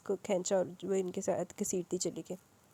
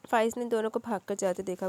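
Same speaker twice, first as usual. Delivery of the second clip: usual speaking voice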